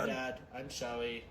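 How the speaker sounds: soft, cowed son; meek apology. Acted. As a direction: apologise timidly, well under your voice.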